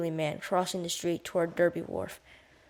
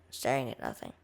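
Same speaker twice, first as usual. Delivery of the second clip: usual speaking voice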